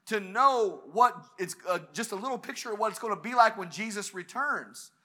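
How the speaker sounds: ordinary delivery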